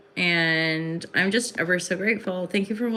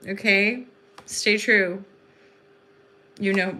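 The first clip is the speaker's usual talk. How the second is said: ordinary talk, nothing marked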